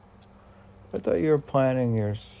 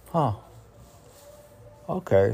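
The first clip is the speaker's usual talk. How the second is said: ordinary delivery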